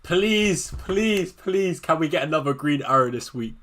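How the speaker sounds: normal talking voice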